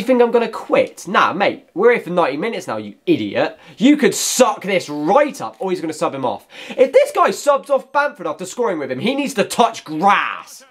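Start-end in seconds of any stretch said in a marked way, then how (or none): none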